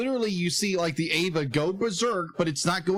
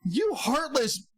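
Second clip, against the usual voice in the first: high, very slow insult; mock outrage; 10 semitones higher, much slower than usual. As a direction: drag out the insult in disbelief. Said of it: "it's just like".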